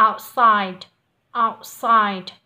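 Outside with the final sound held briefly and not released, said loud; unreleased th. Shown as a demonstration. Teacher modelling Outside for the student, loud. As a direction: pause on the d of Outside without releasing it. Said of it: "khi đọc chúng mình sẽ khựng lại một nhịp".

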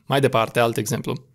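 ordinary talk

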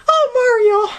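very high, breathless princess. Acted. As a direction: gush at Mario, way up high. Princess rescued by Mario.

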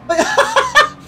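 shrill burst of laughter; high squeal of laughter. Real, not acted. Streamer watching a German car plow into a Brit, squealing laugh. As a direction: squeal with delight.

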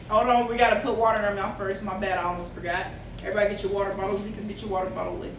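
quick rushed aside; fast. Real, not acted. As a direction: rattle it off fast.

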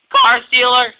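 high, very loud name-calling; shouted accusation. Acted. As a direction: fling the accusation at full voice. Twin hurls accusation at Diesel.

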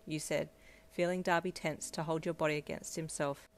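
plain reading voice

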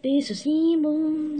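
"Disassemble" stretched slowly, pitched high; slow singsong on "Disassemble"; drawn-out singsong. Pretend. Kid repeats the word playfully.

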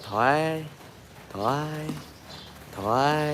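quiet, gentle "ถอย" said softly; soft command to an elephant. Shown as a demonstration. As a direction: say the command gently, barely above a murmur. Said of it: "แต่พูดว่า".

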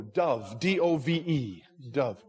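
DOVE said carefully, then spelled out letter by letter; clarifying pronunciation. Real, not acted. Preacher correcting listeners who misheard his accent, clarifying pronunciation. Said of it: "my accent, sorry".

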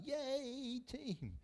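high, drawn-out cheer; God. Acted. God cheering on his team, high drawn-out cheer. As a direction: cheer like a supporter on the sidelines. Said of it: "and God just going".

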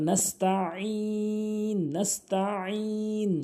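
"নাস্তাইন" with throaty ain and long held "i"; correct pronunciation. Shown as a demonstration. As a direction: chant "নাস্তাইন" high and slow from the throat. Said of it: "আইন এনে উচ্চারণ করতে হবে".

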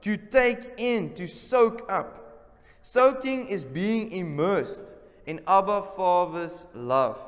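lingering slow preacher's voice; slow, drawn out. Acted. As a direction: draw out each phrase slowly. Slow emphatic definition.

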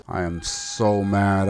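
normal talking voice

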